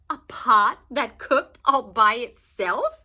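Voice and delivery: loud, slow scoffing question; mocking townsfolk. A narrator's reading. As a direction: scoff at the silly story.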